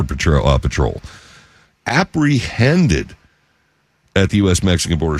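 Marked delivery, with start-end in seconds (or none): 1.9-3.2 "apprehended" very slow, higher, HEND punched; emphasized word. Real, not acted. Host repeating the key word to listeners, "apprehended" heavily emphasized. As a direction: spell out "apprehended" by syllables for effect. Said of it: "you notice I emphasized the word".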